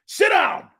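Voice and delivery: high, loud coach's shout; shouted order. Retold or imitated. Coach coming down on college players, shouting. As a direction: imitate a coach yelling a command at the room.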